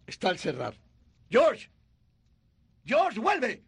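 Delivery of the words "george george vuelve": shouting after him; desperate shout after a runaway; calling out. Acted. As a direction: call him back urgently. Clarence calls after fleeing George.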